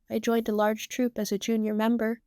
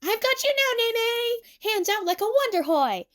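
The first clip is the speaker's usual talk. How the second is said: high, excited exclamation; triumphant cry; 13 semitones higher than usual. A narrator's reading. Emu grabbing Nene at the gate, triumphant cry. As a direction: crow it high as you catch them.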